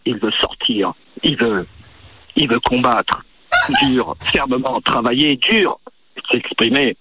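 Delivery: low, gruff man's voice. Acted. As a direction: drop into a deep male voice. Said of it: "une voix d'homme".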